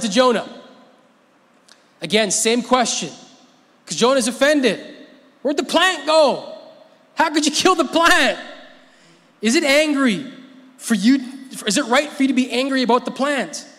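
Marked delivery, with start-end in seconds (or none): 5.4-13.7 high, loud, incredulous questioning; God's voice. Acted. Preacher voicing God questioning sulking Jonah, high and loud. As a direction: ask like a father baffled by a tantrum.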